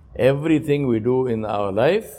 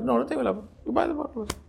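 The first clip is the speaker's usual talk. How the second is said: much higher and softer, almost incredulous; high aside; 11 semitones higher, 9 dB quieter, much faster than usual. Real, not acted. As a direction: float it up as a rhetorical jab. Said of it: "rhetorical aside, pitched up".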